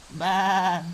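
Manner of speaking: long, steady sheep baa; sheep bleat. Pretend. Mum showing her child the sheep's sound, bleating. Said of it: "What sound does a sheep make".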